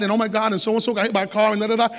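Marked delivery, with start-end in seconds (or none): none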